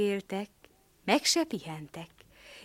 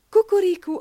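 crowed name; 11 semitones higher, 9 dB louder than usual. Acted.

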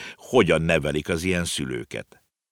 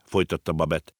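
plain reading voice

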